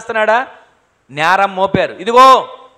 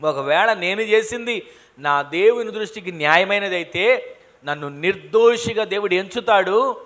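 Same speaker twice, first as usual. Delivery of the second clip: normal talking voice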